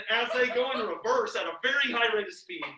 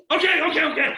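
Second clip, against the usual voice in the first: loud, high, rapid-fire; excitedly rally the others; hurried agreement; 6 semitones higher, 11 dB louder, faster than usual. Retold or imitated. Retelling friends scrambling at the truck, loud and hurried.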